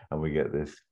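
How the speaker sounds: normal talking voice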